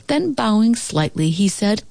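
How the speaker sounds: plain reading voice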